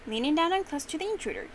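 plain reading voice

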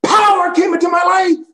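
very high, loud shout. Retold or imitated. Pastor in restaurant shouting his testimony. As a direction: call it out across the room. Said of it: "he shouted in the restaurant".

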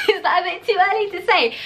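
high giggly question; laughing. Real, not acted. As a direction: laugh through the words. Laughs at own early plug.

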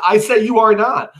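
high, loud insistent comeback; angry retort. Acted. Brutus refusing Cassius's claim, loud and insistent. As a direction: slam the denial back at him.

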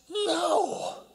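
high sharp scornful shout of "No!"; a stranger scorns the young speaker. Retold or imitated. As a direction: shut him down with contempt. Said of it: "looks at me with absolute scorn".

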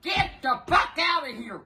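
normal talking voice

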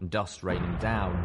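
usual speaking voice

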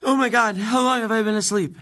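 high, loud alarmed waking cry; panicked surprise. Acted. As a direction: jolt awake and panic out loud. Amy wakes in panic.

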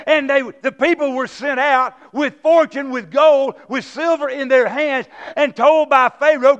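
loud, driving sermon voice; preaching at full force. Acted. Pastor preaching full force to the congregation.

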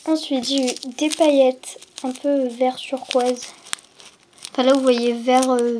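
usual speaking voice

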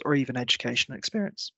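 ordinary delivery

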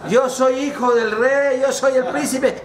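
high, pleading insistence; acted desperate protest; insistent prince. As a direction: insist on your identity, voice pitched high.

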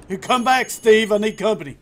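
loud, high-pitched holler; calling someone back. Pretend. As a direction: shout for him to return. Calling Steve back in the game.

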